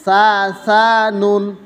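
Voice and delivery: drawn-out chanting of each letter for pupils to copy; slow sing-song drill; slow letter recitation. Shown as a demonstration. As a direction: chant the letters slowly like a Qur'an teacher. Teacher drills Arabic letters.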